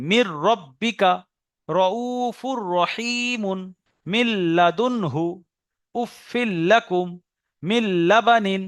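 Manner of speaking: slow Quranic model reading, merged consonants doubled, long vowels stretched; pronunciation demo. Shown as a demonstration. Teacher pronouncing the examples for students, slow measured recitation. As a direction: recite each phrase slowly and separately. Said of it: "আরো একবার উচ্চারণ করে দিচ্ছি".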